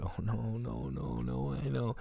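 very soft, fearful murmur to himself; hushed retold panic; quiet dread. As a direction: repeat it under your breath in dread. Dreading father as he walks out.

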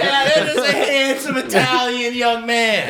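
high, loud, slow protest; laughing protest. Real, not acted. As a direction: insist through laughter. Insists, laughing.

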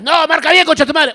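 loud, high, furious curse; angry cursing. Retold or imitated. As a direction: yell at the wrong caller. Angry stranger on phone.

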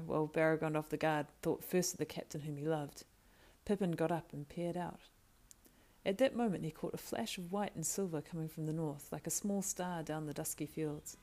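ordinary delivery, nothing marked

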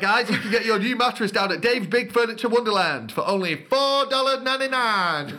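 cheesy ad read. Pretend. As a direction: sell the mattress like a cheap TV ad. Parody mattress ad.

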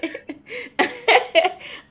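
high, real laugh. Real, not acted. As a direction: break into laughter after tears. Laughs at host's tearful apology.

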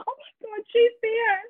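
high, hushed mock squeal; excited. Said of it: "I'll be so excited".

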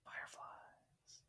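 hushed unvoiced title; whisper. Real, not acted. Co-host whispering the next song title on the list. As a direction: breathe the song name secretly.

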